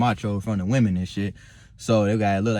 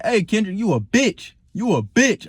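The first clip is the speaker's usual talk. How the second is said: higher, mock yelled insult; retold taunt, a touch louder; 8 semitones higher than usual. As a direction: imitate him calling out across the room. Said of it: "out loud".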